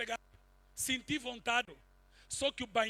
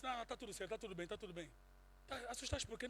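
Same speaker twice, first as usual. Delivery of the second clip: reassuring; 3 semitones lower, 12 dB quieter, faster than usual. Retold or imitated. Comforting a frightened, crying woman.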